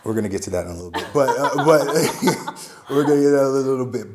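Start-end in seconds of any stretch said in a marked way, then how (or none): 2.9-4.1 laughing. Real, not acted. Laughing deflection.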